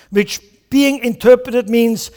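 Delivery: usual speaking voice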